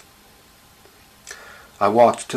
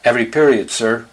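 higher, louder pupil's call; loud classroom clamour; 4 semitones higher, 6 dB louder than usual. A narrator's reading. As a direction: call it out insistently to the teacher. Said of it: "It got loud".

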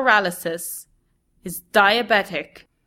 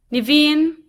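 soft weak call; 8 semitones higher, 4 dB louder than usual. A narrator's reading. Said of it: "a soft, weak voice calls".

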